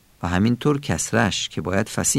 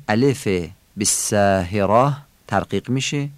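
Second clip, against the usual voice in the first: ordinary talk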